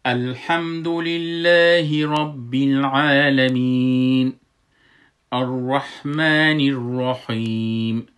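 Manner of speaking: slow, loud melodic chant; slow chanted recitation with a kasra on the nun of الرحمن; Quran recitation. Shown as a demonstration. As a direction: recite slowly with full voice, long held vowels. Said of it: "یه آیت میخوانم".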